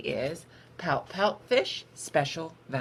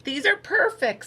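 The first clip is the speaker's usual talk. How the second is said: proud; 11 semitones higher, 8 dB louder than usual. A narrator's reading. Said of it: "growing with pride".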